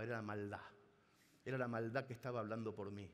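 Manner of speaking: ordinary delivery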